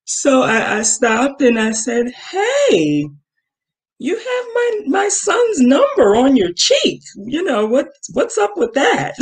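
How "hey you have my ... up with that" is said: high, sing-song fake-friendly confrontation; politely probing. Mom stopping a girl with her son's number, politely probing. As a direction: keep it respectful but pointedly nosy, pitched way up. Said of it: "I wanna still be respectful".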